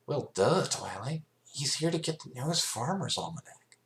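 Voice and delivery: smug tomboy pony butting in; Rainbow Dash voice. A narrator's reading. Rainbow Dash cutting in on Twilight, cocky.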